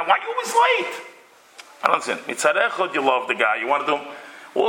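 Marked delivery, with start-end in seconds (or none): 0.0-1.0 high-pitched angry scolding; shrill-free pitched-up complaint at a late friend; yelling. Retold or imitated. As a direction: yell it up high, fed up with him. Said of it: "You start yelling at the guy".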